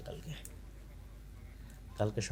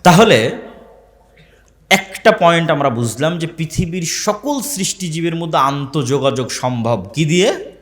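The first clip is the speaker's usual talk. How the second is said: raised, declaiming preacher's delivery; emphatic Bengali sermon voice; sermon preaching; 7 semitones higher, 19 dB louder than usual. Acted. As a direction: preach it to the gathering.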